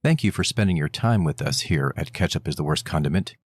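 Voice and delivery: low, measured sign-off; podcast outro read. Acted. Host thanks listeners for their time, low outro read. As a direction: read the contact details like an announcer.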